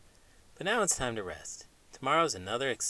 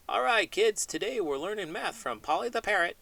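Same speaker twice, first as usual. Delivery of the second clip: higher, louder teacher voice calling the class; mock teacher announcement; 9 semitones higher, 4 dB louder than usual. Adam imitating a teacher announcing a lesson to kids, higher and louder. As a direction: play the cheery teacher introducing a parrot. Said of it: "he'll be teaching my class".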